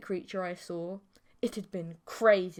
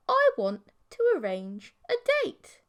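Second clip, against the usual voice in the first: higher, louder demand; high bossy girlfriend; 11 semitones higher, 6 dB louder than usual. A narrator's reading. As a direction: chirp out the order. Creature demands a date.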